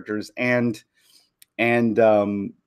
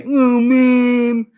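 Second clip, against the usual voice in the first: high, louder mock whine; whiny letdown; 11 semitones higher, 4 dB louder than usual.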